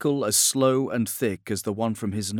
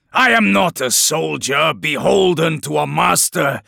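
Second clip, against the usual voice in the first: higher, louder, fierce declaration; loud defiant rant; 7 semitones higher, 9 dB louder than usual. A narrator's reading. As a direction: roar it out with pride. Sevatar's rising rant.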